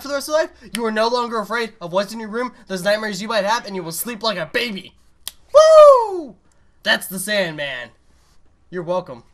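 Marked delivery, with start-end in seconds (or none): 5.5-6.4 very high, loud whoop. Real, not acted. Finishing the Sandman tale with a whoop. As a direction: cheer like you nailed it. Triumphant cheer after the story.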